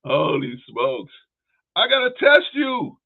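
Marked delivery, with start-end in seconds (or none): 1.8-3.0 mock Peterson. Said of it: "mocking Peterson".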